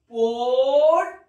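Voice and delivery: পড়ি stretched out slowly and loudly so the final ই rings clear; teacher stretching পড়ি so pupils hear the ই ending; drawn-out demo word. Shown as a demonstration. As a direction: sound out পড়ি slowly, holding the ই at the end. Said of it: "শেষে ই আসছে".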